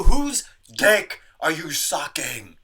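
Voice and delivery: slow, loud disbelieving rant. Real, not acted. Ranting at a lazy receptionist, loud and slow. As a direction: drag it out loud and incredulous.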